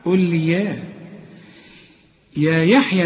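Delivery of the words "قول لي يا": usual speaking voice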